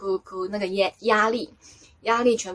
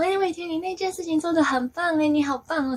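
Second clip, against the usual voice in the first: higher-pitched admiring cheer; gushing praise; 8 semitones higher than usual. Retold or imitated. Angels praising her for cleansing the karma, gushing. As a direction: imitate delighted praise in a lifted voice.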